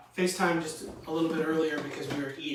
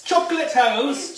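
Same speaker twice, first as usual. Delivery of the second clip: loud, high, drawn-out cry; excited shout; 11 semitones higher, 10 dB louder than usual. Real, not acted. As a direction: shout it out delighted. Shouting a silly exclamation.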